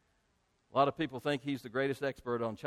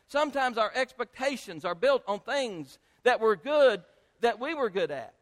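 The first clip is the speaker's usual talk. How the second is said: raised, driving pulpit voice; emphatic preaching; 8 semitones higher, 6 dB louder than usual. Real, not acted. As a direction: press each point on the congregation.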